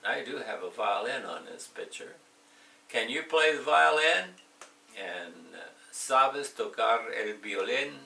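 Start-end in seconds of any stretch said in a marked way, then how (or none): 2.9-4.4 high, loud rising question. Shown as a demonstration. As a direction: lift the end on violin like a real question. Teacher models question intonation.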